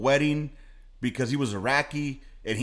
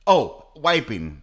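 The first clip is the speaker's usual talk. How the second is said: slow recall; 7 dB louder, much slower than usual. Real, not acted. Remembering the topic.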